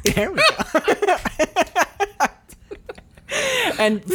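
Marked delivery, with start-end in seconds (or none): 0.0-2.3 high giggling through the words; laughing. Real, not acted. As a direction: laugh as you say it. Awkward high fives laughter.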